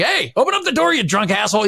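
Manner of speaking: high-pitched retold holler through a door; mock yell. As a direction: imitate yelling at a locked door, not full volume. Retold yelling through a pounded door.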